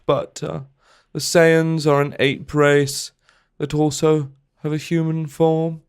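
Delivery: lightly voiced documentary-maker read; a hint of a gentle, curious British presenter; Louis Theroux impression. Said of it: "uh, Louis Theroux".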